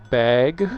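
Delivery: "bag" said slowly as "bayg", Minnesota style; Minnesotan bag. Shown as a demonstration. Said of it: "in Minnesotan accents is to say".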